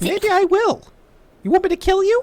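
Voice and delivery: high, loud mock threat; threatening. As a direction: dare him playfully. Inmate threatening guard.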